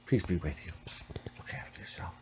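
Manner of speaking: unvoiced whisper fading to almost nothing; whispered farewell. A blessing to the missing Curly, whispered. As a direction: breathe a blessing to him.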